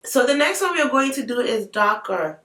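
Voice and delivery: normal talking voice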